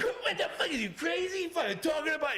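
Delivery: high, sputtering mock yell; outraged mobster. Al answering the teasing salesman, high outraged sputter. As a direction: imitate a tough guy losing it, high-pitched.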